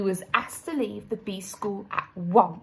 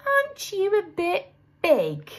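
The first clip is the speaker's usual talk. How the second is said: higher, curious little bee voice; puzzled schoolgirl bee questioning; clever little bee asking; 10 semitones higher than usual. A narrator's reading. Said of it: "said Amelia".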